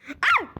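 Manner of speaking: a short high playful cry of "ah"; mock falling yell. The streamer playfully cries out as his avatar falls. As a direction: yelp as the avatar drops. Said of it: "diye bağırmayı unutmadık asla".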